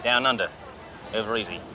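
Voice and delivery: lightly put-on Aussie outback dealer; fake Australian accent. Cop posing as a rich dealer to the salesman, fake Australian accent. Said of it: "Name's Crocodile Bruce".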